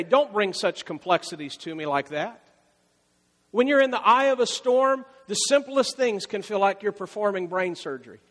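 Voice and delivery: ordinary talk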